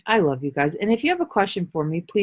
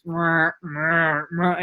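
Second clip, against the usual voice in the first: low mock grumbling growl; grumpy "mrr" noises; 4 semitones lower, much slower than usual. Acting out a person who will not smile, grumpy growling. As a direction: grumble wordlessly like a sulker. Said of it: "So they're like this".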